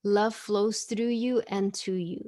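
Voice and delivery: slow, measured affirmation; slow and gentle; slow reverent card reading. Shown as a demonstration. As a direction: read it out like a blessing. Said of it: "Voyage of the Heart".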